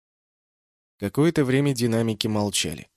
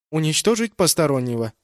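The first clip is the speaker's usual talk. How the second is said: ship computer voice; 5 semitones higher, 6 dB louder than usual. A narrator's reading. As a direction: report it like a ship's AI. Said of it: "спросил корабль". (голос бортового компьютера)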